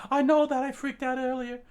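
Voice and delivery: normal talking voice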